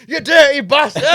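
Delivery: high, loud mock-scandalised shout; outraged yell. Telling friends how he caught the man, yelled. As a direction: belt it out in comic outrage. Storyteller voices his shocked outburst.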